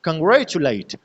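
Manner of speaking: Congratulate with कां and चु weak, grey stressed; unstressed syllable demo. Shown as a demonstration. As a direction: punch grey in congratulate, pass lightly over कां and चु. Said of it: "ये unstressed syllables है". (Congratulate में कां और चु बिना ज़ोर, ग्रे पर ज़ोर)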